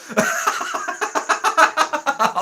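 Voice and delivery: high, wheezy laughing fit; helpless high laughter; real laughter. Real, not acted. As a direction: crack up at the insult. Amused by blind-as-hell comment.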